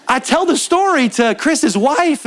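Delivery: normal talking voice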